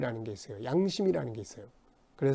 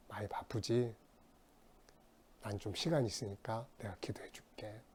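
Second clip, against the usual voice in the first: gentle offer; 3 semitones lower, 8 dB quieter than usual. Retold or imitated. Gently offering to pray for friend.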